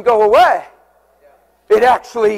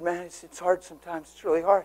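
hushed, much softer, heavy; quiet grief; 5 semitones lower, 13 dB quieter than usual. Retold or imitated. Grieving son to pastor, soft sorrow. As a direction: barely voice the pain. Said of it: "he looked at me and said".

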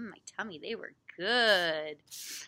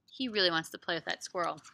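ordinary delivery, nothing marked

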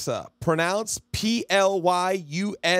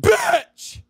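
frustrated shriek; 5 dB louder than usual. Real, not acted. Alexa fails again.

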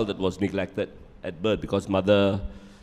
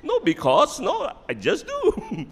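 squeaky, very high, flustered husband. Retold or imitated. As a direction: squeak out a nervous denial.

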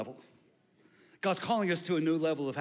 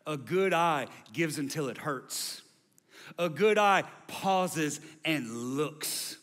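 slowing down; 2 semitones higher, 4 dB louder, much slower than usual. Real, not acted. Pastor slows to build emphasis.

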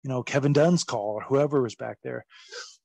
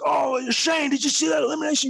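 gushing Pat Patterson; 12 semitones higher than usual. Retold or imitated. Pat Patterson calling Shane over, high excited shout. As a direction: call out to Shane all thrilled. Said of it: "he was like".

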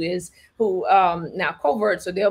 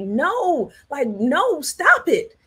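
high, insistent no, ending louder; urging the screen; 7 semitones higher, 3 dB louder, much slower than usual. Retold or imitated. Talking to the screen, high insistent protest. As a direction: tell the screen to stop it. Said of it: "I was talking to the screen like".

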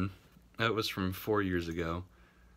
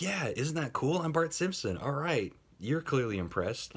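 much higher, smug cartoon boy voice; mock Nancy as Bart; 7 semitones higher than usual. Nancy mocked as Bart, boasting to strangers, high cartoon voice. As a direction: ham up a proud Bart Simpson. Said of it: "And then she's like".

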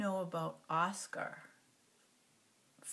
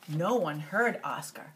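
ordinary delivery, nothing marked

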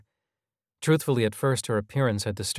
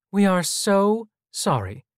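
apologetic mom; 9 semitones higher, 4 dB louder, slower than usual. A narrator's reading.

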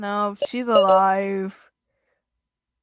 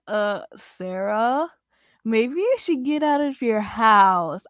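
ordinary talk

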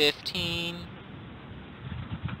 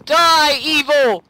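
high, loud attacking shout; mock-heroic war cry; battle cry; 7 semitones higher, 5 dB louder, slower than usual. Real, not acted. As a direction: yell it as you attack.

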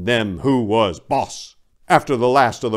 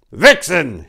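loud, higher bark of the name; commanding shout; 9 semitones higher, 8 dB louder than usual. A narrator's reading. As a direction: call her out like a boss. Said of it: "he shouted in a commanding voice".

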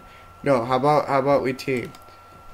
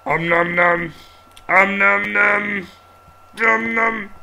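snapping teeth; 6 semitones higher, 3 dB louder than usual. Acted. After "let's eat up.

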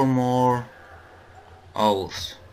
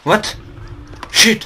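sudden frightened cry, pitched up and loud; jolted by a jump scare, yelp it out; startled yelp; 10 semitones higher, 6 dB louder than usual. Real, not acted. Player startled by a monster, frightened yelp.

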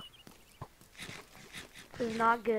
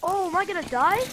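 loud, high panicked cry; 5 semitones higher, 9 dB louder, faster than usual. Real, not acted. Gamer yelling at his dying character in panic. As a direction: yelp in real alarm at dying. Game character about to die.

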